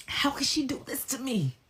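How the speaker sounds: very high, squeaky mock whimper; imitated whiny lament. Man lamenting about Jessica, whiny mock whimper. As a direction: wail it like a wronged victim.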